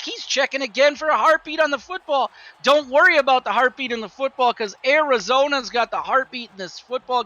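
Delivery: high, loud excited color commentary. Acted. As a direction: ride the touchdown high. Color man celebrating touchdown.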